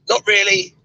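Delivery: prim refusal. Retold or imitated. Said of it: "And I went".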